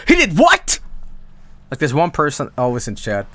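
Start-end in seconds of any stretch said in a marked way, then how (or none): 0.1-0.8 high, scandalised outburst; mock shock. One guy in chat reacting to gossip, exaggerated high shock. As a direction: imitate an appalled chatter, pitch leaping up. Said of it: "one guy in chat going".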